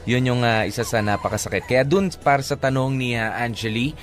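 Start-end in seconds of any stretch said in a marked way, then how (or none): none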